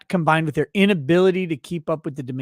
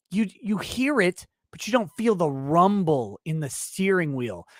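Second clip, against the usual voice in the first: ordinary talk, nothing marked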